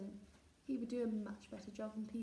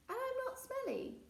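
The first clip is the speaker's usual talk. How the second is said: indignant protest. Acted.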